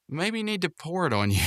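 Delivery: high-pitched imitated female voice; Eileen. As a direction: make her suggestion in a put-on high voice. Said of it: "Eileen says".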